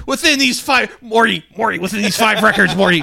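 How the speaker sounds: high, loud cartoon mad scientist; hammed-up ranting at a young sidekick; high-pitched, shouted cartoon voice; Rick. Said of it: "Rick and Morty guy fucking bit".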